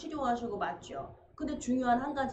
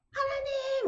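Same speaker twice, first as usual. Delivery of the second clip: panicked cry; slower than usual. Retold or imitated. Preacher acts his own panicked cry.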